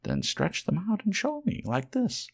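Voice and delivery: wild rabbit challenging. A narrator's reading. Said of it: "said the wild rabbit".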